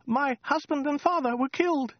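very high falsetto; squeaky woman. A narrator's reading. Woman telling the Khan her day, high squeak. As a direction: report it in a timid squeak.

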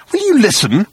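high, exasperated demand; snapped. A narrator's reading. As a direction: snap at him impatiently. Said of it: "he snapped".

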